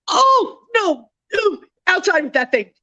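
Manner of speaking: high, crying-out oh no; shocked squeal. Real, not acted. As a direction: squeal in real alarm at the trap. Reacting live to mousetrap catch.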